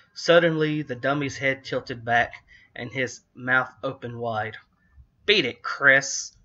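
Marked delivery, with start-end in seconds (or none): 5.2-6.4 slow, louder snarl of an evil dummy; harsh raspy snarl. A narrator's reading. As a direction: growl it like a nasty puppet. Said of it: "he snarled in a harsh, raspy voice".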